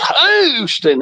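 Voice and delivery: high mock country drawl on Houston; imitated movie accent. Telling viewers the movie punchline, high mock country accent. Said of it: "Chinese guy with that, that accent".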